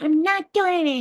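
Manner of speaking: high, squeaky refusal; elf voice. Retold or imitated. Little elf refusing the alien, high squeaky voice. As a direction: dig in as a tiny stubborn elf. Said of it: "I was talking like an elf".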